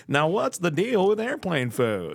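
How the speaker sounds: high, slow mock stand-up bit; stand-up comedian impression. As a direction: do a cheesy stand-up comic. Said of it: "he's just down there going".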